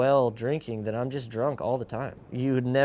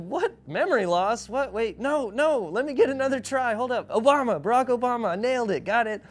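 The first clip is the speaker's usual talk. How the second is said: frantic correction; 12 semitones higher, 5 dB louder than usual. Retold or imitated. Panicked guessing the president.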